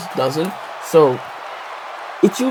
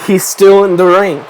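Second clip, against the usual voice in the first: louder ringside announcer call; 4 semitones higher, 7 dB louder than usual. Shown as a demonstration. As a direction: call it out like the commentator. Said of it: "Just say that".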